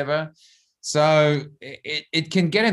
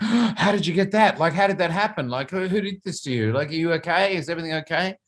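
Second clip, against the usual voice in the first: put-on higher, anxious rapid questions to a child; worried father; 5 semitones higher than usual. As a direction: fret over your little boy's scratch. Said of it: "I'm like".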